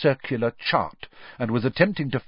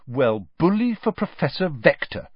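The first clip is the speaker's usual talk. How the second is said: plain reading voice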